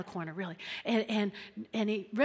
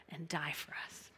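much softer, a breathless whisper; emotional whisper; 6 semitones lower, 14 dB quieter than usual. Real, not acted. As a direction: let it fall to a moved whisper. Emotional climax of the sermon.

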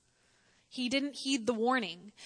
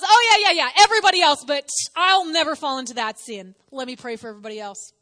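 cocky Peter; 3 semitones higher, 12 dB louder than usual. Retold or imitated. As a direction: shrug off the warning, smugly proud. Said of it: "and goes".

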